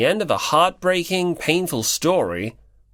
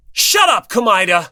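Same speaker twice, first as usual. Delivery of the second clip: loud flustered shout, pitched way up; embarrassed shout; 7 semitones higher, 7 dB louder than usual. Acted. Hinata yelling at Komaeda for teasing him, loud and flustered. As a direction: yell him down, red-faced. Embarrassed friend snapping back.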